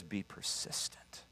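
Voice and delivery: slow, hushed whisper; a secret urging from God. Acted. God urges the congregation to persist, slow whisper.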